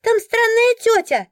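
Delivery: put-on very high, loud child's voice; high, stretched childish announcement; chatty little boy. A narrator's reading. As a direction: squeal it out like a curious kid tattling. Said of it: "любопытный и разговорчивый мальчишка".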